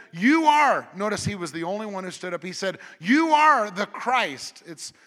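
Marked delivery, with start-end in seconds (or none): 0.1-0.9 bold answer. Acted. Peter's answer voiced. 3.0-4.6 proclaimed. Acted. Peter's confession voiced.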